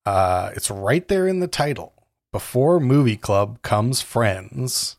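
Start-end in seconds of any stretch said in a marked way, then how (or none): 2.3-4.9 slow and emphatic. Acted.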